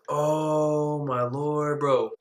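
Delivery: long drawn-out lament; dread. Retold or imitated. Son dreading the trip with mom, drawn-out dismay. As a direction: stretch every word in dismay.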